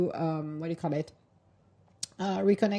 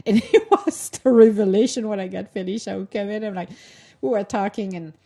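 usual speaking voice

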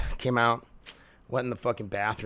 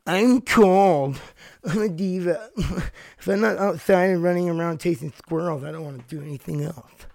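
higher, pouty diva dog; 6 semitones higher, 4 dB louder than usual. Pretend. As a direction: whine like a spoiled diva dog. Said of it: "That's her voice I gave her".

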